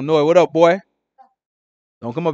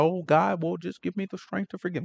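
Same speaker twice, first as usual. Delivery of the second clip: much softer, sugary fake-humble prayer; mock a prayer that isn't meant; pretend pious prayer; 10 dB quieter than usual. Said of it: "hear him pretend with God".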